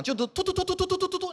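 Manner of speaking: high rapid rat-a-tat, mouth-made machine gun; spray it like a machine gun; machine gun rattle. Retold or imitated. Comic mimics the barber's clippers as gunfire. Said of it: "像被那个机关枪扫过了一样".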